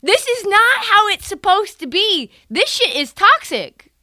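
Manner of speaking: hammed-up indignant protest; mock outraged guest. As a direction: insist it's toxic. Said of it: "you're like".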